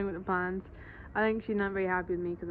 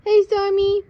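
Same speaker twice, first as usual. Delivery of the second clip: high, loud greeting call; yelling across the room; 12 semitones higher, 16 dB louder than usual. Real, not acted. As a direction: shout hello to the cat below. Calling the cat from balcony.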